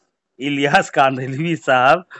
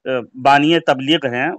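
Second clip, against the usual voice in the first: usual speaking voice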